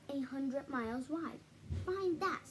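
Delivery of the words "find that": sneer. Acted. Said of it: "sneered the bird".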